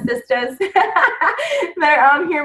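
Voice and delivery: breaking into a real laugh mid-phrase. Real, not acted. Team leader teasing her longtime teammates, laughing. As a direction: laugh through the nickname.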